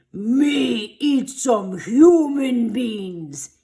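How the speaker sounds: very slow, loud monster bellow. A narrator's reading. The monster holding Sammy, a slow loud bellow. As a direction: rumble it out like a giant beast. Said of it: "he bellowed".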